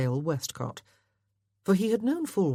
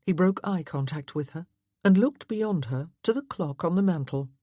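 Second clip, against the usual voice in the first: ordinary delivery, nothing marked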